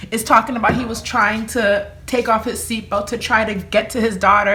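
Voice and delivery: normal talking voice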